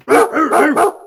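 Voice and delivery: loud, high imitated barking; loud "rr" barks; dog bark imitation. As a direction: bark aggressively like a guard dog.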